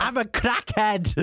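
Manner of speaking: high, laughing crackhead quip. Retold or imitated. Joking about gummies.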